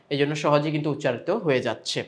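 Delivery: ordinary talk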